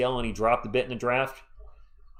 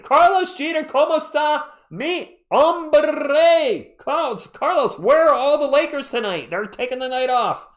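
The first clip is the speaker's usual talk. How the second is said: loud, high-pitched hollered welcome; exuberant greeting; 13 semitones higher, 8 dB louder than usual. Real, not acted. Streamer hailing Carlos as he joins, exuberant greeting. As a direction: belt out an over-excited hello, high and drawn out.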